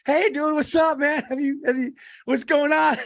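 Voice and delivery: high-pitched, upbeat hello to an acquaintance; excited greeting. Retold or imitated. Guy runs into someone he knows, excited greeting. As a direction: act out an eager hey-buddy greeting. Acting out surprise meeting with acquaintance.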